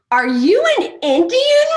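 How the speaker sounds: hammed-up drunk man asking loudly; drunk neighbor's friend. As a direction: blurt it like a tipsy stranger. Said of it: "This guy just goes".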